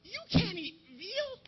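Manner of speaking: very high, soft mock-girl whine; drawn-out, squeaky guilt trip; nagging vegetarian girl. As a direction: plead slowly in a tiny high voice. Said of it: "This girl, my friend, she's a vegetarian. She's always on me".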